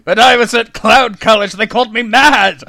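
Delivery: loud, ranting villain voice; mad scientist. Acted. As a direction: rant like a mad genius. Clown villain rant, mad laughter after.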